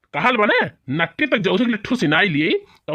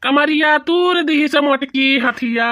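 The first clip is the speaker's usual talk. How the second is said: slow, high cry of pain; wailing in pain; 7 semitones higher than usual. Acted. Husband knocked to the floor by wife, high pained wail. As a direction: groan it out like a hurt back. Husband pushed off bed, hurt.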